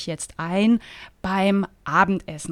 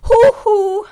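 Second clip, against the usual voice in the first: high, loud sing-song huhu; loud call; 12 semitones higher, 10 dB louder than usual. Acted. Rosi calling across the terrace, loud high huhu. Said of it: "schallte ein lautes".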